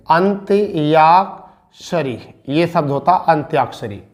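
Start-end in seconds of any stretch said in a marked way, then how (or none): 0.1-2.2 each syllable slowly drawn out; slow dictation. Shown as a demonstration. Teacher dictating numbers to students, slow and drawn out. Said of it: "यानी किसे कहते हैं?".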